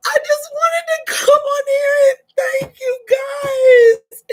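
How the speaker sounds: real happy sobbing; overwhelmed, voice breaking into crying; tears of joy. Real, not acted. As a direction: choke out thanks through tears. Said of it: "They're tears of joy".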